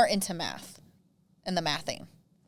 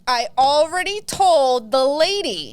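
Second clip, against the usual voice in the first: annoyed retort; 4 semitones higher, 6 dB louder, slower than usual. Retold or imitated. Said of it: "I'm like".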